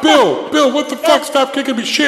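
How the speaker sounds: high-pitched, loud yelping horse character; hurt, angry horse. Acted. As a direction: squeal and curse like a horse just kicked. GM voices a kicked, hurt horse.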